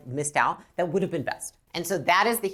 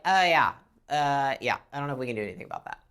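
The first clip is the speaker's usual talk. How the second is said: couldn't care less; indifferent rep; 4 semitones lower, faster than usual. Retold or imitated. Careless rep brushing off an upset caller, bored and dismissive. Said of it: "they're like".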